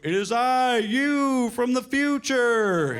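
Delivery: high, drawn-out grand wizard; slow, ringing mystical proclamation. Acted. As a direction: announce yourself like a time-travelling wizard. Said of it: "I'm talking like a wizard".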